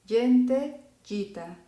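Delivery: gente, gita with soft G like dj; Italian soft g. Shown as a demonstration. Teacher repeats soft G words for students. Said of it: "voy a pronunciar las siguientes palabras como".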